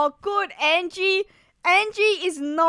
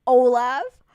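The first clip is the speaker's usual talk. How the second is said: normal talking voice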